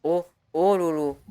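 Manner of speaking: normal talking voice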